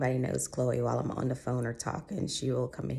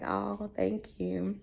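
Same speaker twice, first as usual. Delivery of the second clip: touched thanks; 6 semitones higher, much slower than usual. Retold or imitated. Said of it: "I'm just like".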